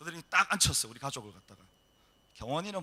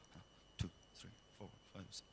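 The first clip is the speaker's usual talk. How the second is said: quiet counting; 8 semitones lower, 18 dB quieter, faster than usual. Retold or imitated. Officer counting pastor's money.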